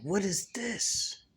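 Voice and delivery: high, slow, shaken question; horrified cry. Acted. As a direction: gasp it out in horror at your own hand. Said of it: "he screams in horror".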